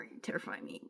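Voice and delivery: breathy hushed whisper to a big bird; half-fearful whisper at a heron; awed whisper. Retold or imitated. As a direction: whisper it like the bird might hear. Spoken to the scary heron.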